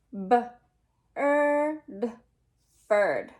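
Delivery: "B-er-d" split into sounds, then "bird" said whole; teacher sounding out bird; sounding out a word. Shown as a demonstration. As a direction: stretch out B, er, d before "bird". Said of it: "Our next word is bird".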